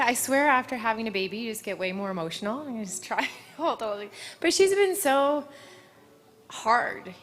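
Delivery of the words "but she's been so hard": fighting back tears as it swells up; voice breaking. Real, not acted. Rider thanking her mare, voice breaking with tears. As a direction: voice cracks high and loud, slowing on the words. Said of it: "I'm gonna try not to cry".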